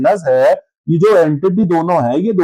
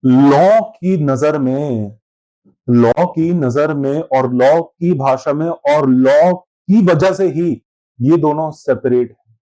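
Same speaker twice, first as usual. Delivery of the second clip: slow deliberate emphasis; 3 semitones lower, much slower than usual. Shown as a demonstration. Teacher stressing the law's role.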